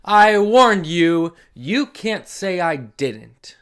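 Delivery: lively, sing-song read-aloud; animated storybook voice with dramatic pauses; reading to children. Acted. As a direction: read it big for the little ones. Reads picture book to children.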